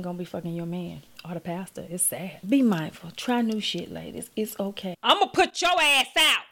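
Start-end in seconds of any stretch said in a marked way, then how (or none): 5.0-6.4 loud, high, sharp threat; threatening to put the dog outside; yelling at the dog. Real, not acted. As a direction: snap it at the dog.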